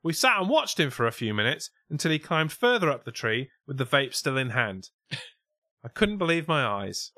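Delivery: lifted, projected mock-Scouse reading; put-on Scouse witness. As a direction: read the witness statement in hammed-up Scouse. Reads witness quote in put-on accent.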